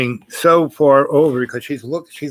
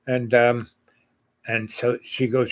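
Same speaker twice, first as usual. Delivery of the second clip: normal talking voice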